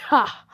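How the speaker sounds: loud, short evil "ha"; one villainous burst of laughter. Acted. Taunting the fans over their favorite, loud evil laugh. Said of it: "In an evil voice".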